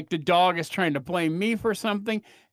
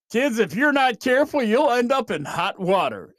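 Aquaman PSA voice; 5 semitones higher, 4 dB louder than usual. Retold or imitated. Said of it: "They'd be like".

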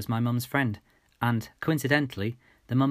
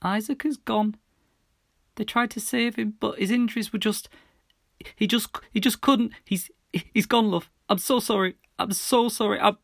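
high, louder, voice breaking into sobs; stammering through tears; crying; 12 semitones higher, 4 dB louder than usual. A narrator's reading. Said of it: "We were both crying now".

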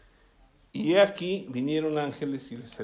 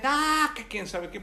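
raised-pitch mock angry rant; imitated angry driver; 6 semitones higher than usual. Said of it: "Se baja bien enojado y me dice".

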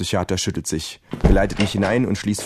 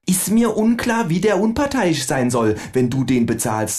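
much higher, slow, mocking kangaroo; higher cheeky kangaroo; 10 semitones higher than usual. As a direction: play the kangaroo as a smug know-it-all. Kangaroo voiced in story.

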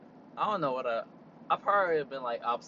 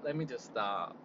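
softer, lower repeat; calming self down; 6 semitones lower, 6 dB quieter than usual. Real, not acted. Cooling off after a rant, quiet self-calming. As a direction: talk yourself down.

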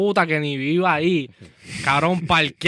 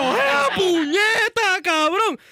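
a much higher, stretched-out, very slow shout of shock; panicked shout; 14 semitones higher, much slower than usual. Retold or imitated. To friends, reliving the moment a man drew a gun, panicked shout. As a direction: yell it as if a gun just came out.

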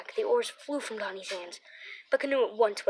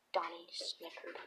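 whispered narration; 4 semitones lower, 11 dB quieter than usual. A narrator's reading.

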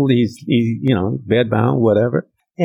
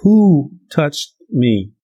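Jesus asking again, more insistent; slow insistent Jesus; 4 semitones higher, much slower than usual. Retold or imitated. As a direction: press the question again, slowly. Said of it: "And Jesus says".

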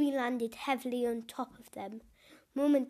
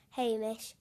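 ordinary delivery, nothing marked

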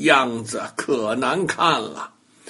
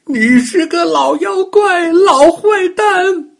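crying shout; 8 dB louder than usual. Acted. Bully crying and yelling insults at the doll. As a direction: scream the insults through tears. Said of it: "哭着嚷道".

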